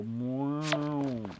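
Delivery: lightly mooed milk; mock cow moo. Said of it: "They go".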